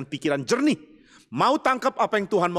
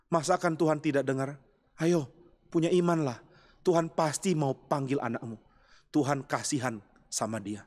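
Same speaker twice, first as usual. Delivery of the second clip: low, soft, consoling voice; hushed pastoral comfort, pitched low and unforced; comforting reassurance; 5 semitones lower, 5 dB quieter than usual. Acted. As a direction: gently soothe a worried mother, voice dropped low. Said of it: "Ambrosius menghibur dia dengan mengatakan".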